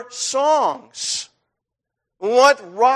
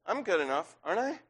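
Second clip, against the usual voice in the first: inner voice; 8 dB quieter, faster than usual. Acted. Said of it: "that inside of you says".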